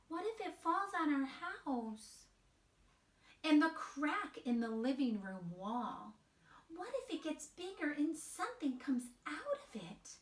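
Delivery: put-on high small child voice; little mouse voice. Wemberly peeking at her parents, high and timid. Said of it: "she's saying".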